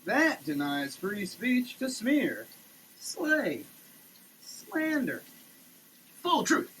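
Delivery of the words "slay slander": ordinary talk, nothing marked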